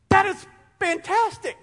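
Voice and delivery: high, delighted exclamation; excited. Real, not acted. Preacher delighting in the name, high excited cry. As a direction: burst out with joy.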